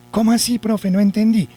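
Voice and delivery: high-pitched puzzled pupil; alumno confundido. Acted. Teacher voices a confused pupil. (alumno que no entendió, voz más aguda)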